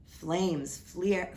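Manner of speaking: ordinary delivery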